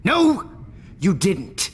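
high, loud snap of no; scornful outburst; sharp loud denial. Acted. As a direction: cut her off hard. Sharp contradiction of Max.

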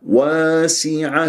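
slow chant, open straight aa in واسعة; correct open alif. Shown as a demonstration. Teacher corrects tilted alif in واسعة, slow open-vowel chant. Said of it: "هذا خطأ".

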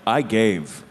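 low, slow, weighty declaration; deep-voiced self-important pronouncement; mock solemnity. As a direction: announce it gravely, drawing out the end. Mock-solemn declaration of his authority.

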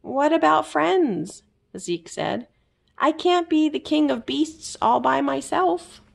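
little king of beasts afraid to be alone; Zeke wistful. A narrator's reading. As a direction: sound lonely without whining.

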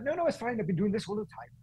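high, lilting Welsh impression; Welsh accent. Race director waves off the drivers' warning, high Welsh accent. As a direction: imitate her in a high Welsh voice. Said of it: "That was a great Welsh accent".

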